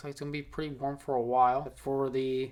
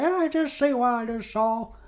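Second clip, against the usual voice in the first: neighbor lady; 10 semitones higher, 3 dB louder than usual. Acted. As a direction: play the scandalized neighbor, pitched way up. Voicing Gladys reporting to parents.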